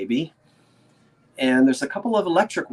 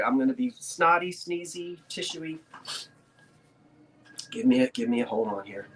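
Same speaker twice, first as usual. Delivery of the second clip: normal talking voice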